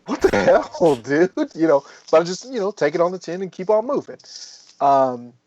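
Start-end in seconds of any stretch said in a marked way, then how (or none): 0.1-1.3 laughing disbelief. Retold or imitated. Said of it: "And I'm like".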